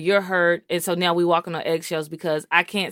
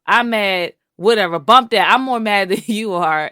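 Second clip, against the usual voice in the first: louder, defiant comeback in an acted-out spat; mock argument; 7 dB louder than usual. Wife re-enacts their fights before cooling off, loud and defiant. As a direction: play a couple's row escalating, half laughing. Acting out a couple's escalating argument.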